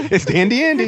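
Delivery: giggling through it; laughing. Real, not acted. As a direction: laugh out the nickname. Jokey nickname with laugh.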